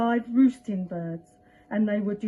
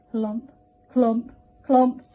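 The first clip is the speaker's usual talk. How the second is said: clomping stick; 4 dB louder, slower than usual. Acted. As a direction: knock each clomp like a wooden stick. Stick sound effect.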